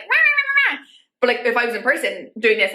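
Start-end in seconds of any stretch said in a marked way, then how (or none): 0.0-0.8 high mock ranting chant. Vlogger mimicking her confident on-camera rant, high. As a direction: blurt it out like an easy rant. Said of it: "be on camera and be like".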